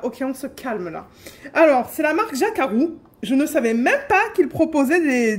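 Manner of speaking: ordinary delivery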